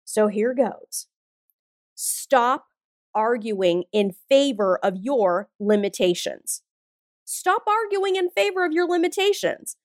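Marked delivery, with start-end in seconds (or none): 1.9-6.6 slow, deliberate. Real, not acted. Coach delivers her key message to listeners, slow and deliberate. 7.2-9.8 higher pitch, one unbroken run; insistent repeat. Real, not acted. Coach presses her key message on listeners, higher and insistent.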